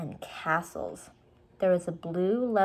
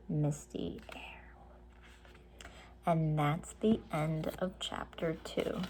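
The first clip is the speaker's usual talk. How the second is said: soft hushed whisper; whispered ending; 2 semitones lower, 9 dB quieter than usual. A narrator's reading. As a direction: hush it for the chapter end. Reader closing chapter softly.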